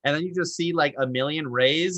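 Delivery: ordinary talk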